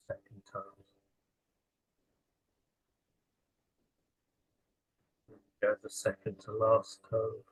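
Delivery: unhurried body-scan guidance with long pauses; calm meditation guide, leaving space after each phrase; guided meditation. Real, not acted. Leading listeners through a lying-down body scan, guided meditation.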